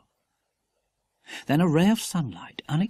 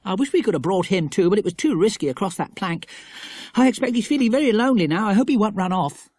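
put-on higher boy voice, quickening; fond worried boy; 6 semitones higher, 4 dB louder, faster than usual. A narrator's reading. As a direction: fret kindly over the dog.

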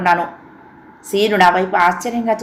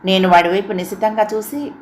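ordinary delivery

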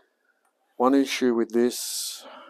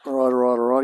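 quoted catchphrase; 8 dB louder, much faster than usual. Retold or imitated. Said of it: "he says".

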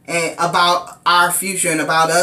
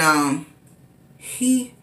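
ordinary talk

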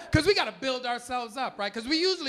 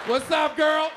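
put-on a louder, higher hey girl across the room; mock loud come-on; loud pickup call; 5 semitones higher, 6 dB louder, slower than usual. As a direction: call out to her boldly. Calling to a girl.

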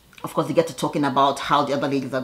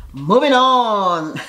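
drawn-out moving on; 4 semitones higher, 4 dB louder, much slower than usual. Real, not acted. Vlogger closing a messy topic, slow drawn-out moving on. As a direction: stretch "Moving on" slowly, a bit higher and louder.

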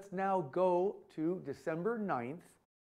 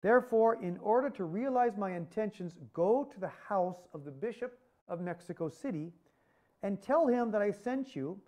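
raised, louder and higher, fervent recitation of a sacred message; solemn devotional reading; 3 semitones higher, 5 dB louder than usual. Acted. Lecturer reading Our Lady's words to Juan Diego, raised and fervent. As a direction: proclaim the Virgin's words with tender urgency.

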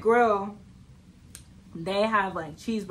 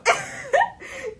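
high laughing squeal; 4 dB louder than usual. Real, not acted. Vlogger cracking up over her friend ordering for her, squealing laugh.

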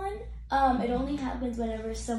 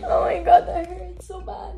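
loud pained cry, half laugh, half sob; real pain breaking through nervous laughter; 4 semitones higher, 9 dB louder than usual. Real, not acted. Patient in pain mid-injection.

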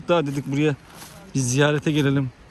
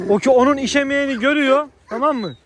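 much higher, fast excited commentary; 10 semitones higher than usual. Real, not acted. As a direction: tell it all worked up. Excited over the dogs. (heyecanlı, tiz sesle anlatma)

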